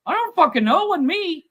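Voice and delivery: high, loud protest of innocence; teen denying the burnouts to the sheriff; defensive denial. Retold or imitated. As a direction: yelp it like a caught teenager. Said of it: "teen denies to sheriff".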